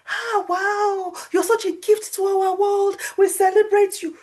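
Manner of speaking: high-pitched mock gushing praise; awed admiration. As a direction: gush like a starstruck fan. Mimics people admiring those up there.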